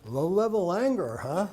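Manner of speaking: high, loud mock-incredulous echo; amused disbelief. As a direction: throw it back as the amused husband. Husband reacts after bursting out laughing.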